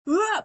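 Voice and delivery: short high outburst; brief acted cry; mock crash-out yell. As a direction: imitate a frustrated "ah". Acts Angel about to crash out.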